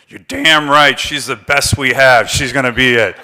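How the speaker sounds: loud, forceful backing of a candidate; emphatic endorsement. Retold or imitated. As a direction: boom it out like a gruff old congressman vouching for her. Congressman's emphatic endorsement retold.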